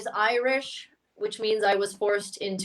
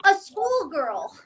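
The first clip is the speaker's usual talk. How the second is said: put-on higher-pitched child announcing a dream; excited little kid; 5 semitones higher than usual. Child running up to mother after seeing [name], eager kid voice. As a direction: play your childhood self bursting with it. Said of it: "I ran up to my mother, and I was like".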